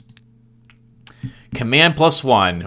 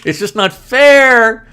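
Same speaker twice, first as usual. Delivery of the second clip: laughing, then higher and louder, slow whine; mock whine; 6 semitones higher, 6 dB louder, slower than usual. Gamer voicing the losing enemy in a mock whine. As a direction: whine like the sore loser.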